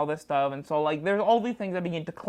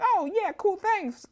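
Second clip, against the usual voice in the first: quoting her; 13 semitones higher, slower than usual. Retold or imitated. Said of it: "she's like".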